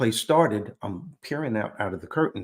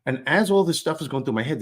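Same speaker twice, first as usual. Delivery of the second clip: ordinary delivery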